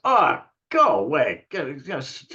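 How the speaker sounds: loud, high, drawn-out brush-off in imitation; dismissive shopkeeper. Seller hearing a tiny offer, dismissive imitation. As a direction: shoo the kid off as the annoyed seller. Seller shoos the boy away.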